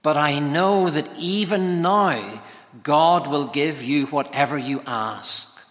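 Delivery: normal talking voice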